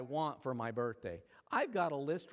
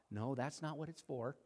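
ordinary delivery

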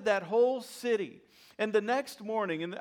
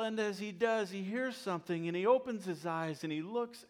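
ordinary delivery

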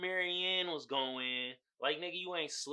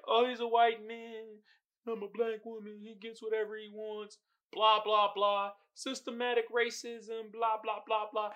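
mocking imitation; 5 semitones higher than usual. Mocking the show's messaging.

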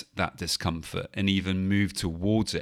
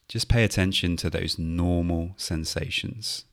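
guided meditation. Acted. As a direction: gently talk them through the breath. Leads a mindfulness body scan.

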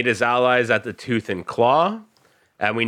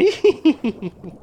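high crazed laugh; mad giggle. Acted. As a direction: let out an unhinged giggle. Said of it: "she just laughs again".

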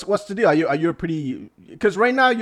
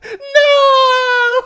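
long, loud falsetto wail; 10 dB louder than usual. Acted. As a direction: wail it out very high and drawn out. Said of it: "he'll make you sound like [name]".

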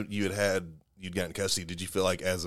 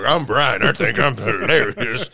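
louder, hammed-up send-up of Brian; mocking Brian impression; 3 semitones higher, 8 dB louder, slower than usual. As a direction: play a self-satisfied Brian, loud and drawn out. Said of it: "do this fake mustache and go".

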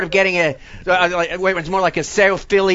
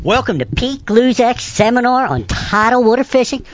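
louder, higher lecture-style intro on helium; seminar intro announcer; 4 semitones higher, 3 dB louder than usual. Pretend. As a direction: open a fishing seminar like a host. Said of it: "do a Bass University introduction".

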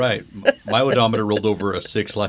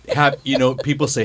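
ordinary delivery

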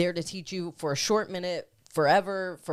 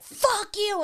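high, loud burst of defiance; retold defiant outburst; 7 dB louder than usual. Friend retelling how she snapped at her brother, defiant outburst. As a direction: fling it out as a sudden retold yell.

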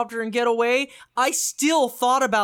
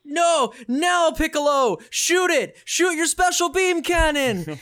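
higher and louder, urging her to fire; urgent Carlos shout; 9 semitones higher, 4 dB louder than usual. Retold or imitated. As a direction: yell it at Jill like a desperate ally. Carlos urges Jill to shoot.

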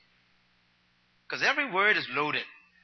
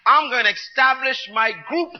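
preaching at full force; 5 semitones higher, 9 dB louder than usual. Acted. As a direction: proclaim it like a fiery preacher. Preacher voicing Christ's declaration.

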